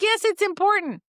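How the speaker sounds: high, loud, emphatic retort; mock exasperation. Practitioner telling patient the symptom matters, playfully exasperated. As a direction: insist with playful outrage at the obvious.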